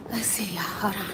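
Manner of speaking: hushed whisper to viewers. Real, not acted. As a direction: whisper so nobody nearby hears. Sneaking toward the commotion.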